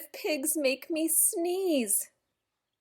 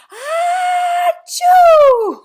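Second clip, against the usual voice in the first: very loud, high shouted achoo; huge sneeze; 16 dB louder than usual. Acted. Elephant sneezes on the cat doctor, huge achoo. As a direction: blast it all over.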